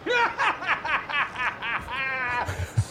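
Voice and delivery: long manic villain laugh; crazed cackle. Acted. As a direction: cackle on and on like an unhinged gambler. Crazed gambler cackles off in distance.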